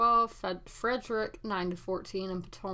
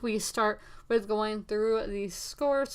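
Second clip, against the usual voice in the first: ordinary delivery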